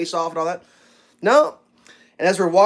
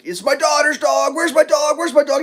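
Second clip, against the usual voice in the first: high, urgent, repeated pleading; retold panic, pitched up; mock freaking out; 10 semitones higher, 4 dB louder than usual. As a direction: imitate a frantic owner looking for his dog. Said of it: "He's just freaking out".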